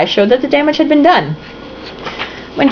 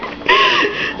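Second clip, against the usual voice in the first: high crying laugh; 4 dB quieter than usual. Real, not acted. Reader breaks down laughing.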